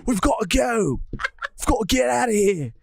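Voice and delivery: put-on British accent, urgent and playful; British Sanji. Sanji urging Nami aboard the Merry, mock British accent. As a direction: keep up the mock British till it falls apart. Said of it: "I feel like my British is not too bad".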